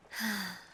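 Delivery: long, airy exhale; lingering sigh. Acted. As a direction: let out a slow sigh of admiration. Admiring the captive by firelight.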